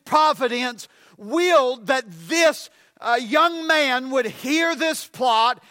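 emphatic, driving sermon delivery; full-force preaching. Real, not acted. Preacher telling congregation God saved Paul's life, full-force preaching.

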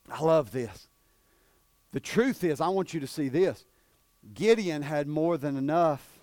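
ordinary talk

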